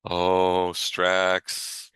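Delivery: normal talking voice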